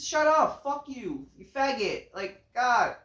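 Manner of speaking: usual speaking voice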